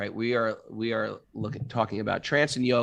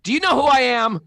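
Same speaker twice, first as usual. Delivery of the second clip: much higher, louder, lordly mock demand; haughty entitled demand; 12 semitones higher, 7 dB louder, faster than usual. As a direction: puff up like a self-important big shot. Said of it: "this is a good one".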